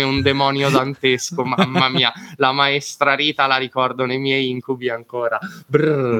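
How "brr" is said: exaggerated "brr" of dread; playful shiver sound; mock shudder. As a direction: shudder theatrically at a bad memory. Shudders at memory of scary teacher.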